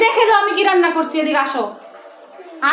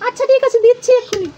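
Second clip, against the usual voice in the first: put-on higher-pitched pouty kid voice; sulky child character; 6 semitones higher than usual. Mother voicing a toy child who sulks off, higher pouty voice. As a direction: play the child toy stomping off in a huff.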